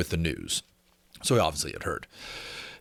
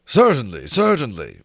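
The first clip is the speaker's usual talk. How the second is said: louder, lofty and dismissive; condescending; 6 dB louder than usual. A narrator's reading. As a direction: grant it as a great favour. Winsdale voiced, condescending.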